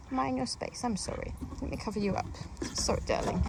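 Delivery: hushed, half-whispered sorry; soft apology to hen. Real, not acted. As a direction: drop to a near whisper so the hen isn't startled. Apologising softly to a laying hen.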